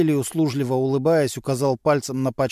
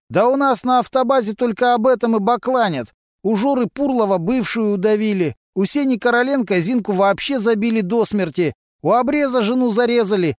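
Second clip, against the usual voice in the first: higher, rough street voice of a petty crook; a sly garage lowlife gossiping to cops; Vasily, a gruff mechanic; 9 semitones higher, 4 dB louder than usual. A narrator's reading. Suspect gossiping to detectives, in a raised streetwise voice.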